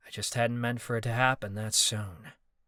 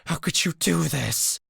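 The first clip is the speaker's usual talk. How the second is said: angry; 5 semitones higher, 4 dB louder than usual. Acted. Marcus angry accusation.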